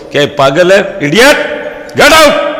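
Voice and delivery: hammed-up rage climbing sharply in pitch; furious receptionist. Receptionist throwing out a nosy guest, furious. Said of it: "madam रो दिमाग खराब है गयो".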